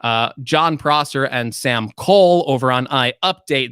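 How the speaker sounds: ordinary talk, nothing marked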